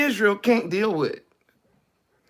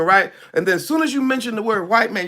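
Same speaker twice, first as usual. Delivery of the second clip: ordinary talk, nothing marked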